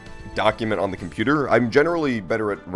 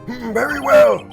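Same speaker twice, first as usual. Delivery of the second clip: put-on high, slow old judge voice; drawn-out old judge musing; old man voice; 13 semitones higher, 7 dB louder than usual. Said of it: "my old man voice".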